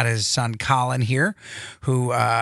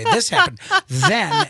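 high sing-song example; 4 dB louder, slower than usual. Shown as a demonstration. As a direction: pitch jumps up high on then. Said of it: "what happens in a story".

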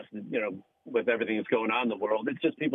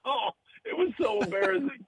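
laughing. Real, not acted. Laughing over the embarrassing moment.

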